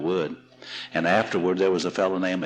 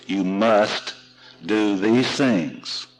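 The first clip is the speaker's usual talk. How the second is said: "must" hit firmly, then slow, deliberate words; emphatic preacher; 3 semitones higher, 3 dB louder, much slower than usual. Retold or imitated. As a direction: imitate a Southern Baptist leaning hard on "must". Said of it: "Southern Baptists emphasize that word".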